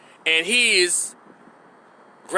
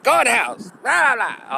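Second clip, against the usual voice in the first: raised, higher mock yell at kids; imitating yelling mother; 5 semitones higher than usual. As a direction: mimic a neighbor hollering at her kids. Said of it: "Always yelling at the kids".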